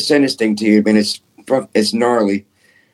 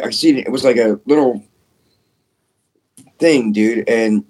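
ordinary talk

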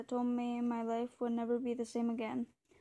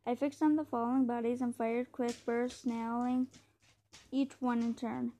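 ordinary delivery, nothing marked

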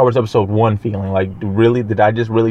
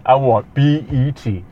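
slow, drawn-out mock movie-character voice; imitating the character; slower than usual. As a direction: quote the Killer Croc line slowly, lightly put on. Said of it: "He, he really goes".

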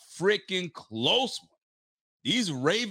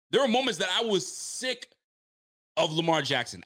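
ordinary talk, nothing marked

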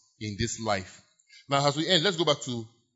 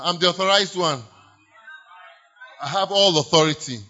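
declaring; 3 semitones higher, 7 dB louder than usual. Shown as a demonstration. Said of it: "Say it now".

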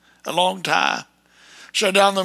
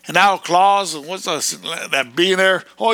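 ordinary delivery

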